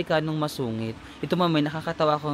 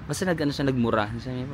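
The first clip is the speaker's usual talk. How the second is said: ordinary talk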